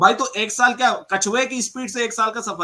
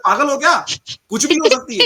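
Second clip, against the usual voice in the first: mocking; 5 semitones higher, 6 dB louder than usual. Mocking opponent's phrase.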